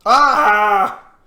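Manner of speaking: sharp high startled "ah"; a loud sudden yelp of surprise; startled cry. Real, not acted. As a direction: caught off guard, yelping. Sudden exclamation while gaming.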